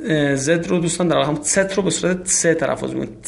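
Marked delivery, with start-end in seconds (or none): none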